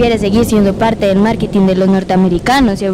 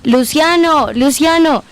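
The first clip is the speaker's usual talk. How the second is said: a raised, higher alarmed call for Luciano; panicked cry; 6 semitones higher, slower than usual. Acted. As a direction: cry out the news in shock. Grandmother relives the boss's murder.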